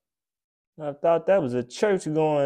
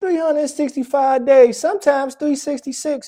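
high ranting emphasis; 12 semitones higher, 5 dB louder than usual. Real, not acted. Ranting about Valentine's Day.

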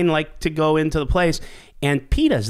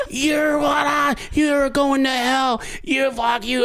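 slow, higher mock yell of angry protesters; mock protester scream; 9 semitones higher, slower than usual. Protesters screaming at the rodeo guest, higher slow mock yell. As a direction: ham up a furious protester, slowed down. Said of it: "and they're like".

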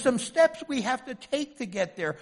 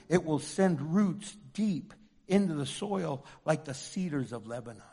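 ordinary talk, nothing marked

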